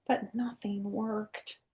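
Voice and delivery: slow, soft disappointed whisper. A narrator's reading. Teacher whispering the letdown to her class, slowly. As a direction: let it fall away in a hush.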